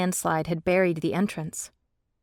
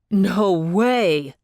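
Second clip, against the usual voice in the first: protesting; 7 dB louder than usual. A narrator's reading. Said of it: "Jonah protested".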